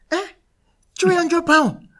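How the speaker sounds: put-on a first go at a Nigerian mum's accent, weighing up the price. Mum eyeing her son's deal in a Nigerian accent. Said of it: "that's a better Nigerian accent".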